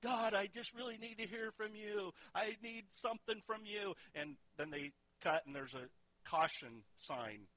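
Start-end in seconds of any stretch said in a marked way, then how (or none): 0.0-3.9 pleading higher Jim Carrey prayer; desperate prayer. Retold or imitated. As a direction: beg God from the car seat. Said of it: "He's saying".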